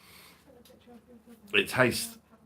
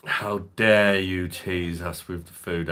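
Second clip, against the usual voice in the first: normal talking voice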